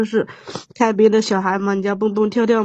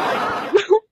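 short laugh; 11 semitones higher than usual. Real, not acted. Guest laughs back at host.

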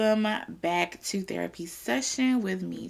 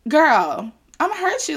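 playful mock threat; 7 semitones higher, 7 dB louder than usual. Real, not acted.

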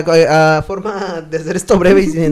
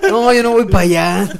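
put-on slow, laughing as the frightened kid; laughing scared kid; 8 semitones higher, 5 dB louder than usual. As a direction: laugh through the kid's refusal.

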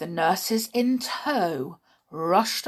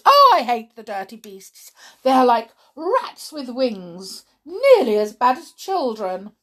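disgusted rant; 3 semitones higher, 7 dB louder than usual. Acted. Matron ranting about pigeons, loud and sneering. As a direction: spit out the disgust.

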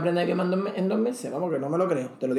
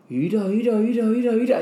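quick run of ira ira, slightly raised; repeated ira; 5 semitones higher than usual. Shown as a demonstration. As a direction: tumble out ira ira ira. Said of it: "por ejemplo, dice".

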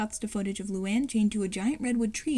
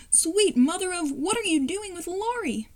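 higher-pitched startled exclamation; shocked outcry; 7 semitones higher than usual. Acted. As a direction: blurt it out in alarmed disbelief. Lincoln shocked by the picture.

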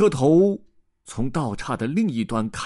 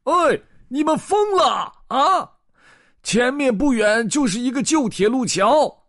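high, loud farmer yelling a warning; high-pitched outraged shouting; angry roar; 13 semitones higher, 6 dB louder than usual. Acted. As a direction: bellow it furiously across a field. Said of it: "突然传来了一声怒吼".

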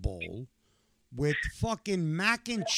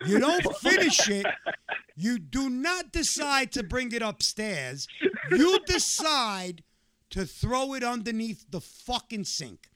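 higher, louder outraged rant at his son; yelling at the kid in disbelief, half laughing; retold furious yelling; 8 semitones higher, 4 dB louder than usual. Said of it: "I scream bloody motherfucking murder".

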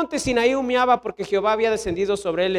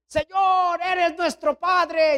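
high, drawn-out cry to heaven; mock shouting; 8 semitones higher, much slower than usual. Young climbers calling to God in a storm, mock shouting. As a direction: imitate youths calling out slowly to God. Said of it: "de repente gritábamos".

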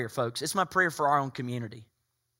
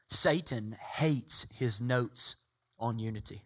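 low, slow, hushed sermon heading; slow announcement; 4 semitones lower, 3 dB quieter, much slower than usual. Real, not acted. Pastor announcing point number two, slow and hushed. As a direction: spell out the point slowly and softly.

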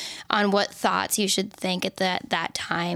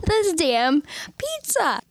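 exasperated; 7 semitones higher, slower than usual. Real, not acted. Laughing at herself over pizza.

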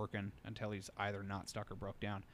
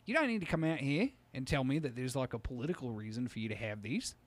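louder, higher bits, lightly Australian comedian; Jim Jeffries; 3 semitones higher, 5 dB louder than usual. Retold or imitated. Comedian telling gun owners to just be honest, in a light Australian accent. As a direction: deliver it like a stand-up punchline. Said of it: "Jim Jeffries".

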